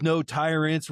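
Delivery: ordinary talk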